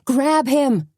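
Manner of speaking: high pleading shout; urgent cry. A narrator's reading. Suzanne pleading with the sheriff, high urgent shout. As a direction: cry it over the crowd's noise. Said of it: "she cried again".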